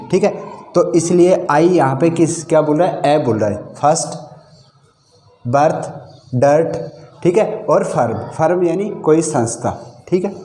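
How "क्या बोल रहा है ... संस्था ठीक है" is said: ordinary talk, nothing marked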